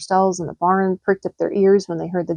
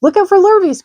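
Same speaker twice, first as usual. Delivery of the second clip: high, loud farm-animal call; cows calling; 12 semitones higher, 7 dB louder than usual. A narrator's reading. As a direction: cows urging the pig downhill. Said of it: "cried the cows".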